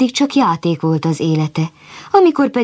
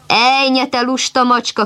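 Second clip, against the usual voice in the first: higher, sharp scolding call of the farmwife; scolding shout; 7 semitones higher than usual. A narrator's reading. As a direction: scold the lazy cat from across the yard. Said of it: "kiáltott rá a gazdasszony".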